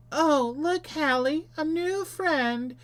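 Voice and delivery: put-on very high little-girl voice; squeaky falsetto twin; creepy girlish twin. Voices woman talking to herself.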